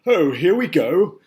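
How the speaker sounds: high, loud, slow excited grasshopper shout. A narrator's reading. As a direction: hop with excitement as you yell it. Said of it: "shouted the great green grasshopper".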